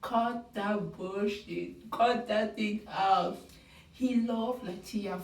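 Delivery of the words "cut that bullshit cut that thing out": slow, deliberate, every word weighted; dismissive. Real, not acted. Fan dismissing the show's drama, slow and scornful. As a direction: spell out the contempt slowly. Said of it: "when they say the bachelor never be so torn".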